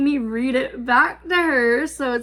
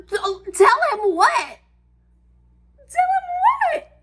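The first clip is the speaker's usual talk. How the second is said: much higher, stammered tell him what; alarmed disbelief; 14 semitones higher, 4 dB louder than usual. Retold or imitated. To the sister about telling her age, alarmed and high. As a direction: caught off guard and alarmed.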